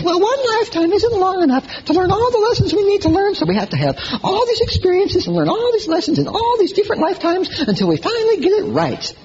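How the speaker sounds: high airy mock voice; mock Shirley MacLaine. Preacher quoting Shirley MacLaine in a high mocking voice. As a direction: lilt it like a serene guru. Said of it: "She says".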